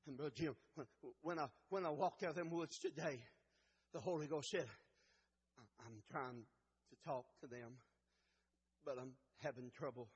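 very soft, low, halting delivery; hushed preaching. Acted. Preacher sharing what the Holy Ghost said, hushed. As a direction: confide it quietly, with pauses.

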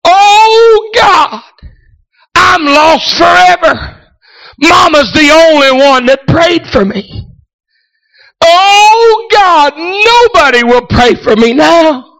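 high, loud, tearful wail; a lost son crying out over his mother's grave; crying out to God. Retold or imitated. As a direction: cry out to God in despair. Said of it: "I cried out".

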